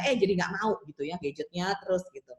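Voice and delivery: normal talking voice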